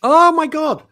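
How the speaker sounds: high, loud "oh my God"; loud high mock outrage; mock horror. Real, not acted. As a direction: react as if scandalised. Reaction to hated word.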